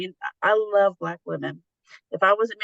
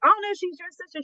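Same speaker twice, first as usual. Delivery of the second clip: shocked protest; 10 semitones higher than usual. Retold or imitated. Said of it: "I was like".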